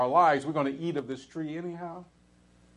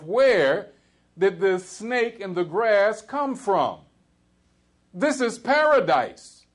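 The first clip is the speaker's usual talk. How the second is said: rising emphasis; 7 semitones higher, 6 dB louder, slower than usual. Real, not acted. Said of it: "rhetorical peak of sermon".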